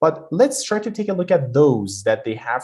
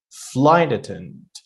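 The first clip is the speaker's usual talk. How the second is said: "flight attendant" slowly, FLIGHT punched and drawn out; exaggerated first-word stress; slower than usual. Shown as a demonstration. Teacher exaggerating so students understand, "flight" stressed in "flight attendant". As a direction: hit "flight" hard, let "attendant" fall. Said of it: "trying to a little bit exaggerate".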